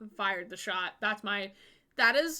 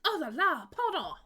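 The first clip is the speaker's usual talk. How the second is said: put-on French accent; 5 semitones higher than usual. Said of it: "She's French".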